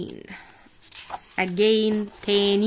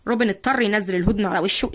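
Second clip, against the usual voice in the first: ordinary delivery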